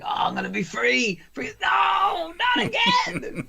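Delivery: hammed-up relief turning to a high, anguished cry; elated turning to despair. Comic mocking a man about to be freed, elated then wailing. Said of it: "he's like".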